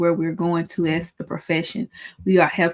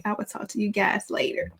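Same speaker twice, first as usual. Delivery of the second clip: whispered sign-off; 3 semitones higher, 6 dB quieter than usual. Real, not acted. Teacher signing off the video, whispered. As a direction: whisper it softly.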